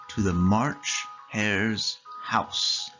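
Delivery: usual speaking voice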